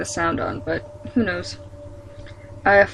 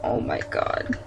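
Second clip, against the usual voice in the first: whispered; 11 semitones lower, 8 dB quieter than usual. Real, not acted. Overwhelmed by the sad story.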